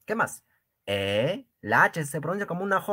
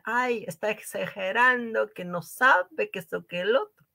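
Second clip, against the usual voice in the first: mock critic; 7 semitones higher than usual. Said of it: "van a haber personas que van a decir".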